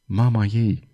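hushed, low aside; quiet, restrained utterance; soft murmur. A narrator's reading. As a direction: murmur it softly. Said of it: "murmură tânărul".

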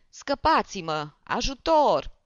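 slow then a loud high cry for help; screaming for help. A narrator's reading. The panicked comic calls for help against the barber. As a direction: plead for rescue in panic.

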